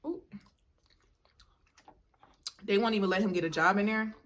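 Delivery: ordinary talk, nothing marked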